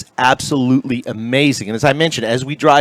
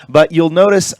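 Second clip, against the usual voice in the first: normal talking voice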